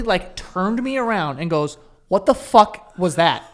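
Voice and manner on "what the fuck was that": angry mom. Retold or imitated. Mom turns him around shocked.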